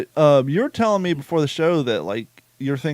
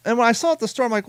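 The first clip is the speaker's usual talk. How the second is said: usual speaking voice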